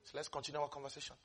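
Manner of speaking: unbothered Jesus. Acted. Jesus turning from the woman to his disciples, quietly unbothered. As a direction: toss it off quietly, ignoring her. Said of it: "as if he didn't hear".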